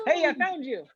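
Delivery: high delighted discovery; bright playful find; excited. Pretend. Found buddy in game.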